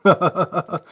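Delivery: short, high self-amused laugh; real laugh. Real, not acted. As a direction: laugh at your own bad Yorkshire attempt. Laughs at own bad accent attempt.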